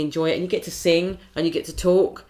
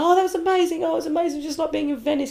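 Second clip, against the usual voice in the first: high, gushy party-guest imitation; mock rapture in a high voice; gushing praise; 13 semitones higher, faster than usual. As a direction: gush like a delighted guest.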